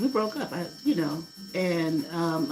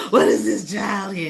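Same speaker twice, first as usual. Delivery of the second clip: a louder, laughing, astonished question; laughing amazement; 4 semitones higher, 8 dB louder than usual. Retold or imitated. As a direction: laugh through the words. Laughing amazement at baby talking.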